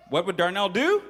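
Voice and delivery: ordinary delivery, nothing marked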